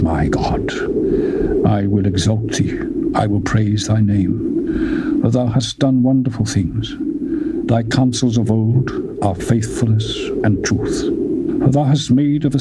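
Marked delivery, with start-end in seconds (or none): none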